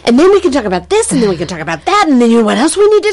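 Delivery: high, rushing, breathless excitement; excited chatter. Retold or imitated. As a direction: gush like an overjoyed kid who can't stop planning.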